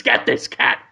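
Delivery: rough mock pet-store seller; put-on New York-style character voice; Danny DeVito. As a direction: pitch the cat in caricature. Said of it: "Danny DeVito says".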